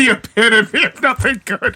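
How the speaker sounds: words half lost in a high laugh; laughing through it. Real, not acted. Cohost echoes the episode title while cracking up.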